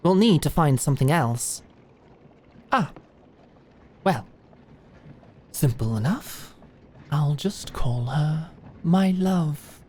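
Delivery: ordinary talk, nothing marked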